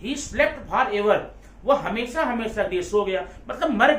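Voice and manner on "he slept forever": very slow at normal level; slow dictation. Shown as a demonstration. Teacher repeating the euphemism for notes, very slow. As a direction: repeat it slowly for writing. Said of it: "या बोल देता है".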